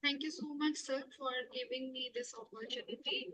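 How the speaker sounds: faint, far-off thanks; almost lost in the line; barely audible. Real, not acted. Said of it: "Your voice is very low".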